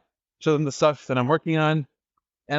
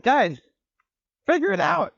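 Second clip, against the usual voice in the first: much higher, fed up; pitched-up exasperated figure it out; exasperated order; 11 semitones higher than usual. Retold or imitated. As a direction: throw up your hands at the team.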